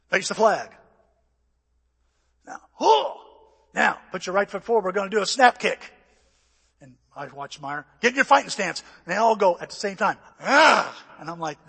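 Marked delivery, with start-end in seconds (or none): 0.1-5.9 loud imitated drill orders; mock taekwondo teacher, projecting; instructor's command. Acting out instructor drilling the class. 8.0-8.8 loud imitated drill order; mock martial arts instructor, projecting; instructor's command. 10.4-10.9 loud imitated class kiai; forceful mock battle cry; martial arts yell.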